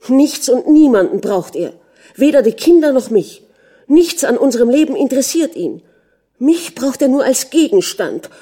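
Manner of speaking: high, loud, bitter inner tirade; outraged contempt. A narrator's reading. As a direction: rail against him with scorn. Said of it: "Ihre Empörung, ihre Verachtung".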